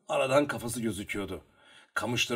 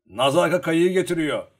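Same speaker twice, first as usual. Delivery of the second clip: loud, high call; shouting; 6 semitones higher, 9 dB louder than usual. A narrator's reading. Uryadnik telling Luka the boat is coming, shouting. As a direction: holler the news across the water. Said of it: "diye bağırdı".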